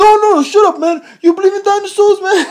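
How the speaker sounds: high, loud, scolding comedian impression; indignant, high-pitched celebrity impersonation; Tracy Morgan. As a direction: berate them in an exaggerated high voice. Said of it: "he talks really funny".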